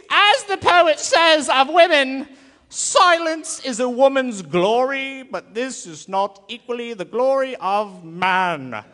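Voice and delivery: loud, high, slow pompous philosopher; hammed-up booming ancient sage; Aristotle voice. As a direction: proclaim it like a self-important old philosopher. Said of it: "in a very Aristotle voice".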